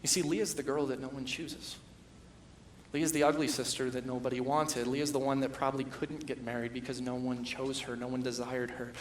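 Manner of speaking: ordinary talk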